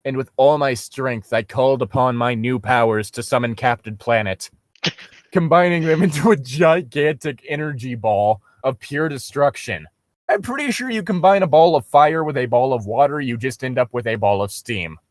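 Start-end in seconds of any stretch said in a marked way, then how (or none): none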